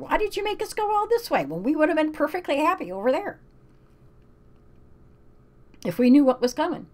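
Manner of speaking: high, fast whiny plea; complaining Joshua. Acted. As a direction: plead with God in exasperation. Paraphrasing Joshua's complaint to God.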